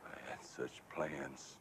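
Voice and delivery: very slow, low and soft; heartbroken. Acted. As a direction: sigh out lost hopes. Lost hopes, long pause.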